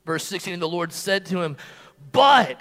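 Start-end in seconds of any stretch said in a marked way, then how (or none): 2.1-2.6 loud, emphatic interjection; forceful counter to an objection. Acted. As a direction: punch the word "But" hard. God's emphatic answer to doubting Gideon.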